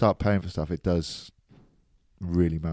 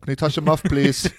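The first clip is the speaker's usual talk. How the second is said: mock commentator pleading; 8 semitones higher, 6 dB louder than usual. Repeats quote louder.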